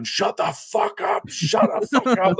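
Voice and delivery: high, slow, strained shout of shut the fuck up; yelling through the cell wall at a noisy neighbour; retold screaming. Prisoner screams at the man in the next cell, high and slow. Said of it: "like, screaming at him".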